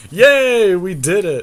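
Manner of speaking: hammed-up childish cheer; mock celebration. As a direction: fake triumphant cheer after a pointless win. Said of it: "I'd just be like".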